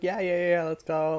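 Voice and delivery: eager, loud and high agreement; all hyped up; excited. Retold or imitated. Friends' eager reply quoted.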